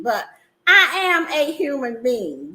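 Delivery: ordinary delivery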